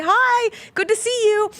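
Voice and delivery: high-pitched, friendly hello; retold cheery greeting. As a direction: greet brightly, as if waving from afar. Acting out a friendly wave hello.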